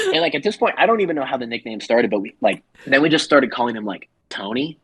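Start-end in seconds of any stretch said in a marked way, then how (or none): none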